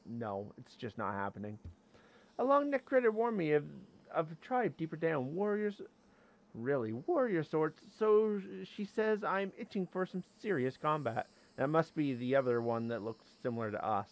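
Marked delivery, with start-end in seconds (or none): 2.4-11.3 high-pitched little critter voice; high, chirpy creature role; friendly tiny creature. Acted. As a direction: read it as a small creature greeting an adventurer. Reads creature's game dialogue.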